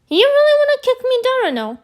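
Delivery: high, rapid-fire challenge; retold indignant retort. Acted retort to mom's yelling.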